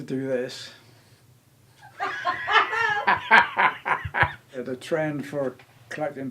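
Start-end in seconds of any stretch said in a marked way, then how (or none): 1.8-4.5 a high burst of laughter. Real, not acted. Husband laughing at his own camera joke.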